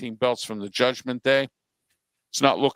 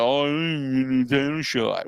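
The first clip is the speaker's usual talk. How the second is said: whiny. Retold or imitated. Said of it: "they're whining and crying".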